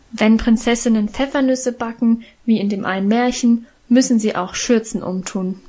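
plain reading voice

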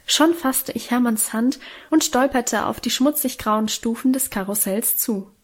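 ordinary delivery, nothing marked

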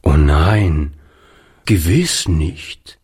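a firm low "Oh nein", then a slow "gewiss nicht"; old man insisting, low and slow; emphatic. A narrator's reading. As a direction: reassure him firmly, slowly. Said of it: "versicherte Oliver nachdrücklich".

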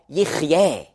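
"Yechyeh" as YECH-yeh with a throaty ch; Hebrew word. Shown as a demonstration. Preacher teaching the third Hebrew word, careful Hebrew. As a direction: give "Yechyeh" its Hebrew ch sound. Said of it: "das dritte Wort".

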